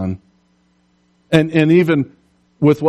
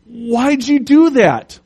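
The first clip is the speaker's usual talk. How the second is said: high, slow, hurt cry; dismayed frog; 10 semitones higher, 4 dB louder, slower than usual. Acted. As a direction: ask it as betrayed and sinking. Frog stung and sinking.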